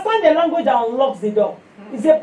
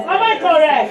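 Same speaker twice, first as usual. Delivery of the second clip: ordinary talk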